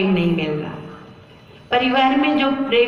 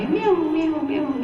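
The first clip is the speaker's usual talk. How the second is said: imitated meow; 7 semitones higher than usual. Cat meowing at sleeping master's ear.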